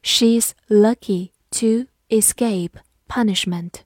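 slow, deliberate sentence model; slow teacher read-aloud. Shown as a demonstration. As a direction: read it slowly word by word. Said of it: "好，慢读一遍".

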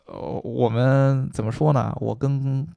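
ordinary delivery

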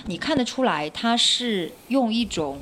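ordinary talk, nothing marked

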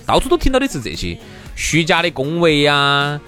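usual speaking voice

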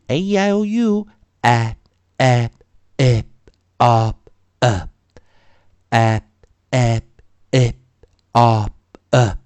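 A, E, I, O, U as short "a, eh, ih, o, uh"; isolated short vowel sounds for A E I O U; short vowel drill. Shown as a demonstration. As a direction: cut each short vowel off, pause between. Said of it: "会带出一个短元音".

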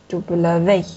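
"W" as dou-ble-VÉ, weight at the end; final-syllable stress on French "W"; stress on last syllable. Shown as a demonstration. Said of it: "一个放后面了".

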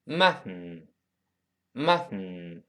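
"mutton" with the t released through the nose; slow, let the tt pop out the nose; nasal plosion. Shown as a demonstration. Teacher demonstrates nasal plosion in "mutton", very slow. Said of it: "读快了叫".

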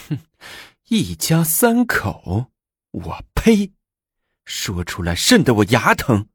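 drawn-out, high-pitched scoff; scornful. A narrator's reading. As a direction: sneer slowly, then spit it out. Empress scoffs at consort's words. (拉长声调地鄙夷嘲讽)

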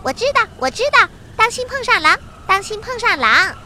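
high, very fast impatient chirp; blurting little rabbit. A narrator's reading. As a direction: cut mum off, rattling it out. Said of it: "就嚷嚷着". (又高又快地抢着嚷嚷)

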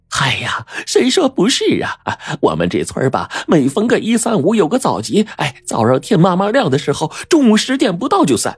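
high-pitched landlady eager to agree; chatty landlady. A narrator's reading. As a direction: complain as if to a kindred spirit, pitched high. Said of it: "老板娘却仿佛遇见了知音似的".

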